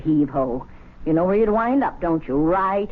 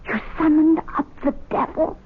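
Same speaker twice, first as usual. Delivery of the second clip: alarmed surprise; 9 semitones higher than usual. Acted. Wife alarmed at devil notice.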